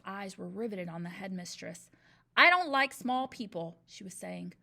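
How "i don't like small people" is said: booming. A narrator's reading. Headmistress addressing class.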